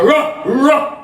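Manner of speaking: high-pitched double bark; dog bark. Acted. Taunting a rival to battle, barking. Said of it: "And we back".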